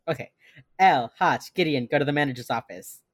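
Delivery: usual speaking voice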